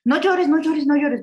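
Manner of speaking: mock a parent hushing a crying kid; scolding parent.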